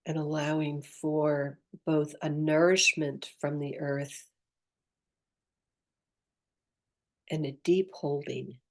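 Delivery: soft, unhurried meditation voice with long pauses; guided meditation. Acted. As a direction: gently guide the listener inward, leaving space after each phrase.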